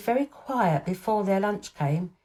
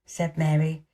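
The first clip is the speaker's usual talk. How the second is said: plain reading voice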